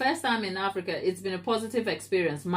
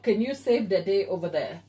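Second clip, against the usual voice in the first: ordinary talk, nothing marked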